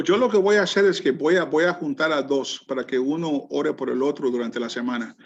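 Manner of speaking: ordinary talk, nothing marked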